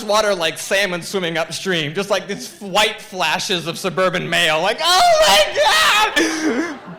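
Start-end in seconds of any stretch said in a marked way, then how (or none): 4.8-7.0 very high, long mock scream; panicked screaming. Two friends bursting from the water fleeing wasps, screaming. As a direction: wail it loud and drawn out.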